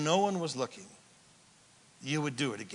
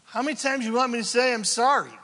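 higher, fast exasperated retort; irritated; 6 semitones higher, 4 dB louder, faster than usual. Acted. Spouse snaps back after repeated apologies, irritated. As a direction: act out a fed-up spouse. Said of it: "get a little irritated".

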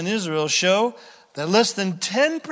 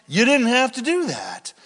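ordinary delivery

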